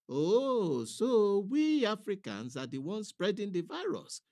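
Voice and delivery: high, loud mock outrage; sarcastic. A narrator's reading. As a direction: throw the words back at him with scorn. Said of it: "Pa replied sarcastically".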